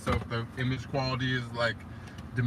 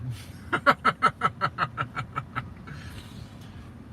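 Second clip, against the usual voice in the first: high burst of laughter tailing off; long, trailing giggle; 3 semitones higher than usual. Real, not acted. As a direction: laugh at your own disclaimer. Laughs at his own disclaimer.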